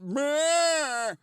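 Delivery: high, dopey imitation of a bleat; idiot bleat. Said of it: "like an idiot in the balcony".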